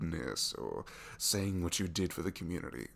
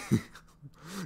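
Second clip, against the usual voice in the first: giggle; 10 semitones higher, 6 dB louder than usual. Real, not acted. Laughing at impression.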